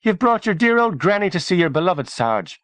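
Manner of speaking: high-pitched mock Irish teasing; exaggerated stage-Irish banter; mock Irish accent. A narrator's reading. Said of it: "he said in a mock Irish accent".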